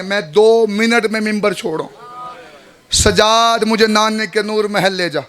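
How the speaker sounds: usual speaking voice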